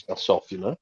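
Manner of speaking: ordinary delivery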